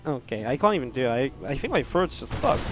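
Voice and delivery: ordinary delivery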